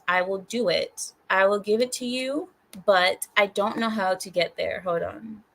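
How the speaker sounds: ordinary delivery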